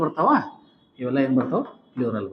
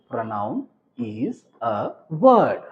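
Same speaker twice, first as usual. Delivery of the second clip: word by word, very drawn out; teacher spacing out each word; slow dictation; 5 semitones lower, much slower than usual. Shown as a demonstration. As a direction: dictate it slowly for note-taking.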